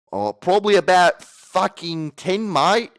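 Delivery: high, loud, slow caricature voice; hammed-up retake. Pet cremator boasting his daily record, loud hammed-up voice. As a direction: blow it up into a shouted cartoon reply. Redoes the Irish line in bigger voice.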